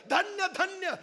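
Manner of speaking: high, loud proud proclamation; king shouting praise of brave women; rousing royal shout. Acted. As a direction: leap up and hail them. ताडकन उठले राजे.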